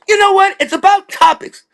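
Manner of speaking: very high, forceful stand-up voice; high-pitched comic impression, punching the phrases; Chris Rock. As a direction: squeal it out like a ranting comedian. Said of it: "And Chris Rock goes".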